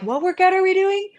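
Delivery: a high, confused squeak of questions; flustered high voice. Retold or imitated. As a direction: play it scatterbrained and high. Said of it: "and then I'm like".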